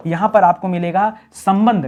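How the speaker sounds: usual speaking voice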